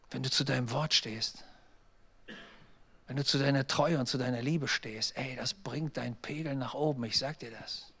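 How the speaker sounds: low, soft, sincere pastoral appeal; earnest appeal. Real, not acted. Pastor urges spouses to keep their word, earnest and hushed. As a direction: drop your voice and speak straight to the heart.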